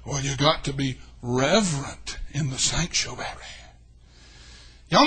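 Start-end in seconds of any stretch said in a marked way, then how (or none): 0.0-3.2 lightly mocked stiff church member; a hint of prissy disapproval; low, soft mock reverence; prim churchgoer. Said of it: "they say".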